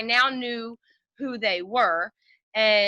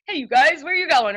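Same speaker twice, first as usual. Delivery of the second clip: louder, higher mock shout; 5 semitones higher, 7 dB louder than usual. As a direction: call out from the window playfully. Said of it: "come out shouting".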